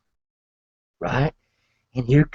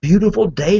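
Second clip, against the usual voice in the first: usual speaking voice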